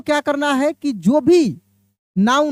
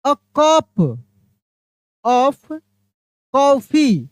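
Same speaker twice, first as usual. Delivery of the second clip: each word set apart, cup and coffee leaned on; slow teacher's demo of a cup of coffee; slow emphatic model phrase; much slower than usual. Shown as a demonstration. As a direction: spell it out word by word for the class. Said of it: "देखिए यहाँ पे ध्यान दीजिएगा".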